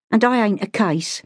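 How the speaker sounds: high, tart Cockney brush-off; Cockney street girl, defiant. Acted. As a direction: take your leave with mock courtesy. Street girl takes her leave.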